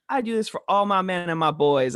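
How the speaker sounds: a hint of a doting Southern cashier; Southern lady voice. Cashier telling about her boys, lightly imitated in a Southern accent. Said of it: "she's got a Southern accent".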